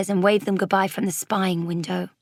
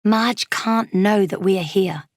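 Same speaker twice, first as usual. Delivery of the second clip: ordinary delivery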